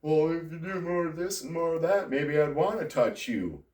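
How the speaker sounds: high mocking wife voice; imitating wife, high and sneering; wife's demand. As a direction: mimic the wife's condescending terms, pitched well up. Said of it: "and I was told".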